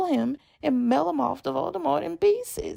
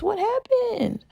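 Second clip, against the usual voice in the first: high, slow, disbelieving; exasperated; 9 semitones higher, slower than usual. Real, not acted. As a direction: ask it in disbelief. Exasperated rhetorical question.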